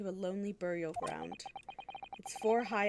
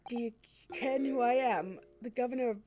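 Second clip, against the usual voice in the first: Scottish prison governor; 3 semitones higher than usual. Acted. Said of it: "this man is Scottish".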